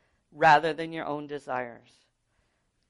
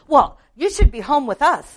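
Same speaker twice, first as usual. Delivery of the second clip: mimicking sister-in-law; 8 semitones higher, 11 dB louder than usual. Mimicking sister-in-law's complaint.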